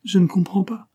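high, lightly voiced whimper; whimpering French maid. A narrator's reading. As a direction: plead helplessly, pitched well up. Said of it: "pleurnicha-t-elle avec un fort accent français".

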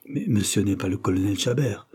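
ordinary delivery, nothing marked

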